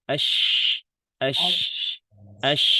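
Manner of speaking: a teacher drilling the letter shin with sukun; hushed sh sound. Shown as a demonstration. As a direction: sound the sh of "ash" as a broad steady rush of air. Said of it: "Sedangkan kalau shin".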